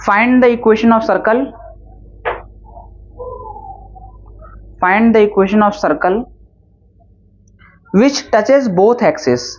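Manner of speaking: word groups drawn out with long pauses; measured classroom dictation, repeating each phrase; slow dictation. Real, not acted. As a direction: dictate slowly so students can write it down. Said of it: "लिखिएगा".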